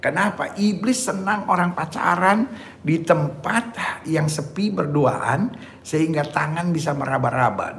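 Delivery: ordinary delivery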